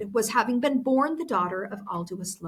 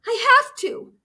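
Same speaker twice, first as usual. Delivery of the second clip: tearful; 14 semitones higher, 7 dB louder than usual. A narrator's reading. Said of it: "Tears filled her eyes".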